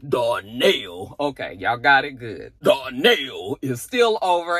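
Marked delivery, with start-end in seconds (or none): 0.1-1.1 low, stretched-out Darnell; exaggerated fatherly Darnell in a low voice. Shown as a demonstration. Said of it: "Say it with me". 2.6-3.6 low, stretched-out Darnell; exaggerated fatherly Darnell in a low voice. Shown as a demonstration. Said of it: "say it the way J P say".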